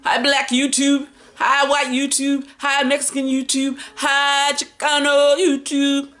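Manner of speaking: high, slow, drawn-out hellos; stretched-out cheery shout-outs; sing-song greeting. Acted. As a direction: greet each audience in a playful sing-song. Playful sing-song greetings to audiences.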